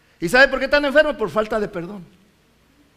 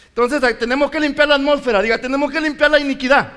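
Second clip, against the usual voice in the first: loud, ringing call for the church to repeat; preaching at full force; 5 semitones higher, 7 dB louder than usual. Acted. Preacher to congregation, loud rousing call to repeat after him. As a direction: drive the line home to the congregation.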